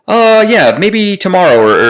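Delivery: high, careless call-centre voice; mock support rep. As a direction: imitate a shrugging helpdesk agent. Mimicking indifferent Spectrum support.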